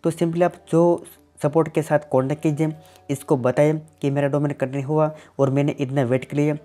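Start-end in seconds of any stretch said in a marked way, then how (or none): none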